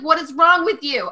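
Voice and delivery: high-pitched retold annoyance; imitating mad husband. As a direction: imitate an incredulous, fed-up husband. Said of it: "My husband got mad".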